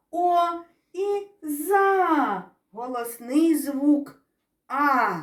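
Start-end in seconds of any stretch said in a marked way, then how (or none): none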